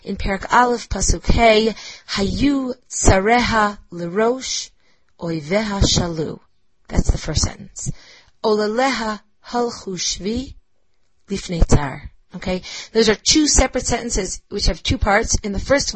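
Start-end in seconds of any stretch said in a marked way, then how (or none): 2.1-12.1 "Hayu tzareha lerosh", pause, "oiveha shalu", the second half falling away; limping kina meter shown. Shown as a demonstration. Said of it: "I'll read you a couple examples".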